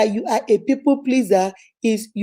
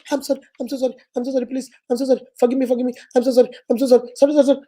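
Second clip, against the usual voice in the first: frantic apology; much faster than usual. Retold or imitated. Mock over-apologizing.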